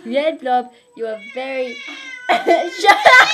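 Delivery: shrieking and laughing at the blob; girl yowls and screeches at the red blob, laughing; screeching yowl. Acted. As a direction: screech it out, cracking up. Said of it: "she yowled and screeched at the red blob".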